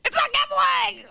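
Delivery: high desperate cry; panicked whine. Retold or imitated. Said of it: "he goes".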